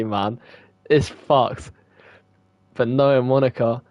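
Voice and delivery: ordinary delivery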